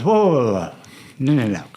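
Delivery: lightly acted reining-in; cautioning older brother. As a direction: imitate a big brother putting the brakes on. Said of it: "And he goes".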